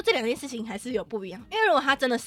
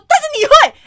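very loud, high furious shout; 17 dB louder than usual. Retold or imitated. To the groper after his excuse, furious shout. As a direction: explode at him. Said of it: "我直接理智断线".

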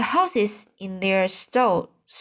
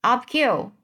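mild, higher-pitched call; cried out; 4 semitones higher than usual. A narrator's reading. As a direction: urge him on with a lightly raised cry. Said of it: "cried the sheep".

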